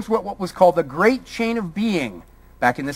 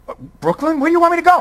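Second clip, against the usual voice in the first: high-pitched comic incredulity, getting louder; mock bewilderment; 10 semitones higher, 4 dB louder, much faster than usual. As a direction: act baffled, voice jumping up high. Comic reply to "go back.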